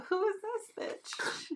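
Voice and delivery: high, shocked "who is this"; mock outraged daughter. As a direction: squeal it like a startled kid. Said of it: "she's like".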